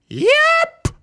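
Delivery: very high, loud shouted yep; the small lad's big yelp. A narrator's reading. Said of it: "and he shouted".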